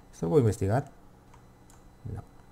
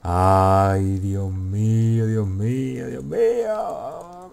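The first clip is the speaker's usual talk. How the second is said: overwhelmed exclaiming; 4 dB louder, much slower than usual. Real, not acted. Gamer reacts to an epic find, overwhelmed wail. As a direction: stretch each word, sliding steadily upward.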